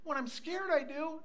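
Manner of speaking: put-on small, high-pitched child voice; scared grandchild. Grandchild explaining the night light, small high voice. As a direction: imitate a timid little kid.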